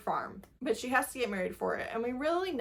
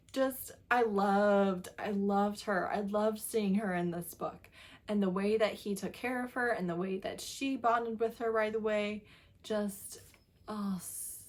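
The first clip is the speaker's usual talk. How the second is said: usual speaking voice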